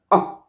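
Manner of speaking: "A" as a very short, fast uh; schwa sound. Shown as a demonstration. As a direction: clip "A" to a quick neutral schwa. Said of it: "The sound is a short sound".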